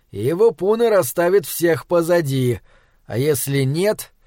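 plain reading voice

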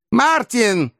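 put-on high-pitched old lady's call. A narrator's reading. Old lady to her coachman from the carriage window, calling out. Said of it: "крикнула из переднего окошка старая леди".

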